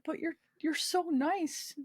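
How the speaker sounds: high, slow, mildly tearful; trying not to cry. Retold or imitated. Nurse to an injured patient, a touch tearful. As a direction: lightly imitate a choked-up nurse. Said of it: "trying not to cry".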